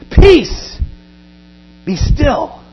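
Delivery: high, loud call then settling; commanding rebuke. Acted. Jesus rebuking the wind and sea: loud commanding call. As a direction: command the storm to stop. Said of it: "he rebuked the wind".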